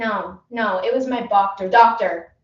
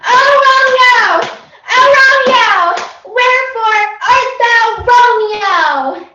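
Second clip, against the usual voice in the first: very high and loud, half-sung; screeched Juliet; 11 semitones higher, 10 dB louder than usual. Acted. Overeager actress screams Juliet's lines at the casting director. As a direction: shriek the lines like a diva. Shrill overacted audition.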